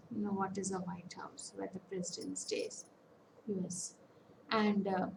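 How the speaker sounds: ordinary talk, nothing marked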